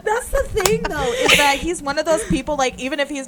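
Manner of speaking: excited. Real, not acted. Gushing about member.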